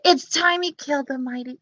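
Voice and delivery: plain reading voice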